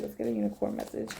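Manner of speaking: a soft breathy whisper to the cards; hushed request. Real, not acted. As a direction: whisper the ask, almost to herself.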